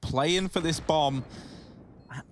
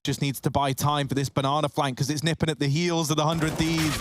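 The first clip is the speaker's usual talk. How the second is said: ordinary talk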